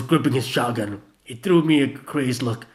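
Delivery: usual speaking voice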